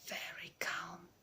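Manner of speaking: slow, soft whisper to himself. Acted. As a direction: whisper it, steadying his nerves. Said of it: "he whispered to himself".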